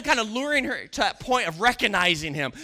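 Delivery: usual speaking voice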